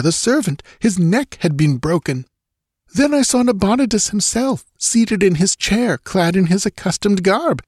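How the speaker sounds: high-pitched, fearful recounting; horrified young nobleman. A narrator's reading. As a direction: relive the horror in a high voice. Murilo recounts the horror he found.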